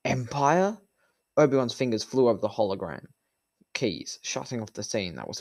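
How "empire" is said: shocked question. Acted. Obi-Wan shocked by word.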